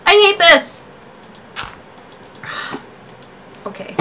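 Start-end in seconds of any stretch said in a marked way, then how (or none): 0.1-0.8 high, loud frustrated cry; frustrated yell. Real, not acted. Frustrated after failing.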